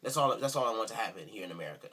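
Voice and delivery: ordinary talk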